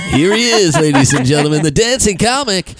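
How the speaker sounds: high, loud showman announcing the act; mock emcee intro. Comic pretends to introduce the dancing comic, loud and high. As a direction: hype up the dancing comic like a cheesy host.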